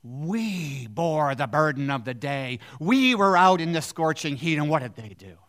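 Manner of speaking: workers, resentful toward the master, loud and bitter; bitter protest. Acted. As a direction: protest as if cheated.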